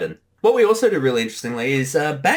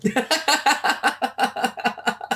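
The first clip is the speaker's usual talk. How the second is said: long high giggle. Real, not acted.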